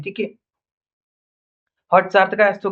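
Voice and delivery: usual speaking voice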